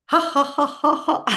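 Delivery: high "ha ha ha" running into a long laugh; spelt-out ha-has tipping into laughter; deliberate laughter exercise. Shown as a demonstration. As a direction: laugh on purpose in steady high pulses. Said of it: "We better breathe and laugh again".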